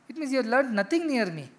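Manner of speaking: ordinary delivery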